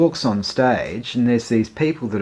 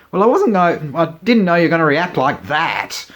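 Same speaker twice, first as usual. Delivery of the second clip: defensive; 6 semitones higher, 6 dB louder than usual. Retold or imitated. Defending himself to mum.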